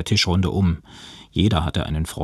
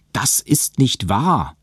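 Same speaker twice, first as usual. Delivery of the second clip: higher, slow emphatic protest; firm denial; 6 semitones higher, slower than usual. A narrator's reading. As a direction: contradict firmly, word by word. Young woman contradicts the accusation. (entschiedener Widerspruch)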